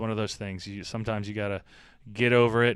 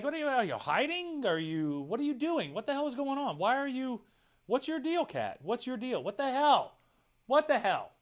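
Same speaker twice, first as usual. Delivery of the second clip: much higher, puzzled questions to the hiding cat; baffled talk to cat; 11 semitones higher than usual. Retold or imitated. Owner quizzing his cat under the bed, high and puzzled. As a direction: ask the cat what on earth it's doing, pitched way up. And I'd be like.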